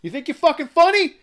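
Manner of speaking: very high, loud confrontational yell; re-enacted furious shout; angry scream. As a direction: scream it at rowdy kids to call them out. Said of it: "I scream at these kids".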